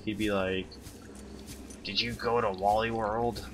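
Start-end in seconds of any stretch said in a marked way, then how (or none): 1.8-3.4 lightly voiced old man; old guy voice. Streamer mimicking chem teacher, hint of old guy voice. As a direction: imitate an old teacher, only slightly. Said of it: "in an old guy voice".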